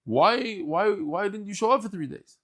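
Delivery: high, pressing why-why-why; demanding an answer from an old friend; indignant question. Acted. Sage questions Elijah in story.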